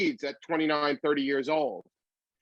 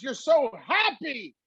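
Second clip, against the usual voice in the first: higher, slower producer voice; imitating Gary Marshall; 8 semitones higher, slower than usual. Gary Marshall tells the comedian he is happy, higher voiced. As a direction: marvel at it like an amused old producer.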